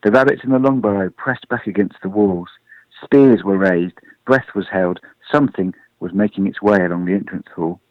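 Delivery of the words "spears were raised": usual speaking voice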